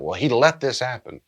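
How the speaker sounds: voicing an angry mourner; acted anger. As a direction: play the angry griever. Acted angry griever in dialogue.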